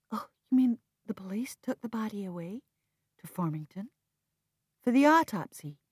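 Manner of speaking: surprised, a breathy rising question; startled question. Acted. Narrator startled as brother breaks news, asking. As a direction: catch up in sudden surprise.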